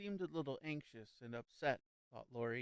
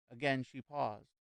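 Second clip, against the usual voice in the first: ordinary delivery, nothing marked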